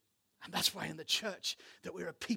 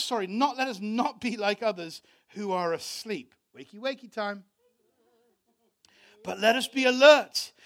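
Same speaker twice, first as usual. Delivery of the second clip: preaching at full force; 5 semitones higher, 10 dB louder than usual. Acted. Pastor preaching Thessalonians to his church with fervour. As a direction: thunder the verses over the church.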